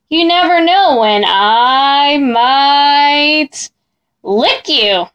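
slow, drawn-out fake menace; mock terrifying. Mac tries to frighten the boy with a slow mock-scary threat. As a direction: ham up a scary monster threat. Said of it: "trying to sound terrifying".

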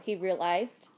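ordinary delivery

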